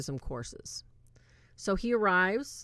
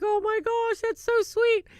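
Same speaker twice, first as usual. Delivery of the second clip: much higher, louder, gushing over something sweet; gushing squeal; 7 dB louder than usual. Retold or imitated. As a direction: squeal it like it melts your heart. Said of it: "I was just like".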